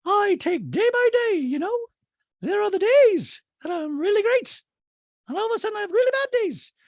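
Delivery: hammed-up squeaky impersonation; Dorit. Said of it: "And Dorit pauses and goes".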